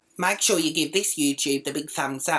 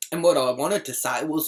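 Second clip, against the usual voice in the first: ordinary talk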